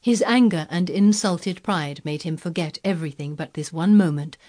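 ordinary delivery, nothing marked

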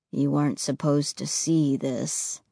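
low, quiet heavy voice; sorrowful ghost boy, low and soft. Acted.

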